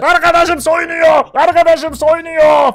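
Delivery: high, loud, drawn-out announcement; gleeful-prank style holler, stretched out; shouting. Acted. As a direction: yell it for the whole neighbourhood. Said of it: "diye bağırmıştım".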